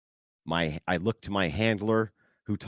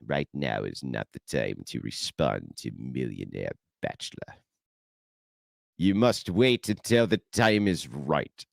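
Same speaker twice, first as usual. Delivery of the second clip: a lower, mock-sinister handler voice, slowing at the end; imitating handlers; 6 semitones lower than usual. Handlers telling the host to wait, lightly sinister. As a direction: deliver it like a shadowy advisor. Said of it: "the Palpatine voice".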